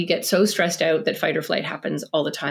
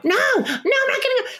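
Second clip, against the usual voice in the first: put-on high, far-off childish refusal; whiny child. Four-year-old refusing to leave the bath. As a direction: dig in like a stubborn toddler. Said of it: "a four-year-old won't get out of the bath".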